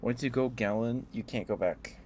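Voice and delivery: ordinary talk, nothing marked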